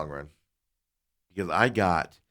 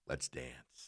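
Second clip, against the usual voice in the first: low, soft, gearing up for a fight; inner fighting words; 7 semitones lower, 17 dB quieter than usual. Retold or imitated. Customer psyching himself up against the clerk, low and soft. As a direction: murmur it under your breath like a challenge.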